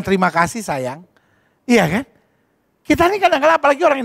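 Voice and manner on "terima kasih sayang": soft, low grateful voice; tender thanks. Shown as a demonstration. Wife thanking her husband, soft and tender. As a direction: say it lovingly. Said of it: "boleh dong kita bilang".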